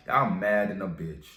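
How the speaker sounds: normal talking voice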